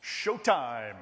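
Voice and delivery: loud drawn-out showtime call; hype announcer. Retold or imitated. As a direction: belt it like a resort DJ. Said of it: "Mexican all-inclusive entertainment person".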